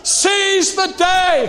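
loud, high, slow battle cry; shouted exhortation. Real, not acted. Preacher urging Alison to seize the day, shouted exhortation. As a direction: shout it slowly across the room.

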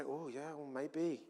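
soft, hesitant half-agreement; doubtful murmur. Acted. All the people answering the bad report, doubtful. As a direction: waver quietly, as if half persuaded. Imagined crowd's doubtful reply.

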